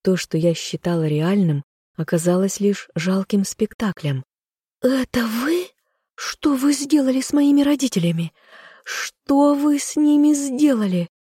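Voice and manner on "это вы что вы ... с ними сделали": desperate cry. A narrator's reading. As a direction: scream it in despair. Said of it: "закричала я".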